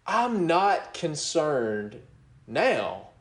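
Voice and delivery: usual speaking voice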